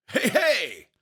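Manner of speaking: loud, high hearty holler; bellowing big pal. A narrator's reading. Nervous dad to his son, bellowing big pal voice. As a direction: bellow it like everybody's best pal. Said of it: "in his bellowing big pal voice".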